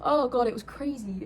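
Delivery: ordinary talk, nothing marked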